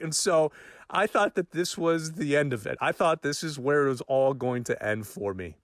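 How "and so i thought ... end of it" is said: ordinary talk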